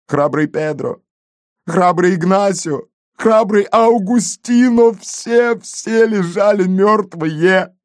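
high, drawn-out mourning of comrades; tragic lament. A narrator's reading. Gendarme painting his fallen comrades as heroes, tragic. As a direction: build the roll of the fallen into a high wail. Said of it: "он в трагических чертах обрисовал катастрофу так".

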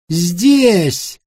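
high call from the corridor. A narrator's reading. Unseen man answering from the corridor, calling out. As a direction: call it out from another room. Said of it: "из коридора донеслось".

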